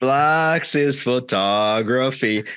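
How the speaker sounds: "Blacks is photography" drawn right out, announcer-like; commercial tagline. Retold or imitated. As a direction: imitate the ad: "Blacks is photography", very slow. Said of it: "Because the commercial".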